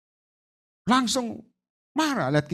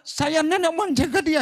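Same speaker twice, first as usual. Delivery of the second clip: a spirit claiming its charge; demon voice; 10 semitones higher than usual. Retold or imitated. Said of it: "bukan Yesusnya".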